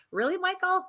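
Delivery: high-pitched, incredulous call-out; teasing disbelief. Real, not acted. She teases Michael for vanishing from the stream, incredulous. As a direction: pitch it way up, mock-scolding.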